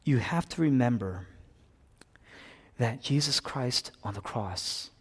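ordinary talk, nothing marked

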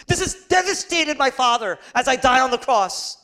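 building, forceful declaration of loss; anguished impassioned preaching. Acted. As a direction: preach it as a lament at full force. Preacher voices Jesus's loss at full force.

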